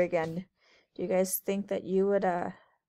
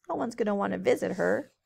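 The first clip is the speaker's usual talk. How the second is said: usual speaking voice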